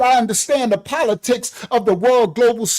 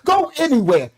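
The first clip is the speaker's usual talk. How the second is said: ordinary delivery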